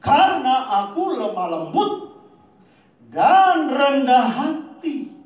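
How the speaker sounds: loud, slow, lifted proclamation; preaching the verse. Acted. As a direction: stretch out rendah hati in a big preaching voice.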